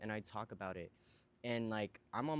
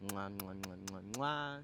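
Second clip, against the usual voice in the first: playful kiss sounds to camera; blown kisses. Real, not acted. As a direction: smack out a string of kisses.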